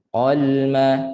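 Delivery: "Qalma" with the l held for a moment; unhurried lam. Shown as a demonstration. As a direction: linger on the l of "Qalma". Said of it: "ada tempo sikit lam kan". ("Qalma" ada tempo pada lam)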